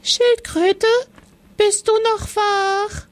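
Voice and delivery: a tiny mouse calling to the turtle; small mouse voice. Acted. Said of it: "eine kleine Stimme".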